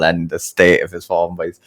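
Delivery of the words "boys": ordinary talk, nothing marked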